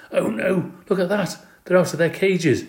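high-voiced dismayed discovery; alarmed exclamation. A narrator's reading. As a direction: cry out in alarm at the mess. Woman discovers escaped pets.

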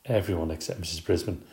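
usual speaking voice

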